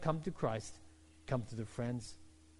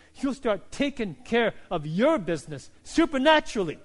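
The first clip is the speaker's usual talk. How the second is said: louder and higher, driving exhortation; full-force preaching; 5 semitones higher, 6 dB louder than usual. Acted. Pastor exhorting the congregation to serve, preaching at full force. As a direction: push the altar call home.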